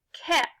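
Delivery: "ket" with a full short e; blended syllable. Shown as a demonstration. Teacher blends the tapped sounds for pupils, full vowel. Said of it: "Finger tap this one with me".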